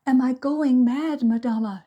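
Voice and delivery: high, loud tearful plea; frightened maid sobbing to her mistress; sobbed. A narrator's reading. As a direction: beg through sobs. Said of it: "she sobbed".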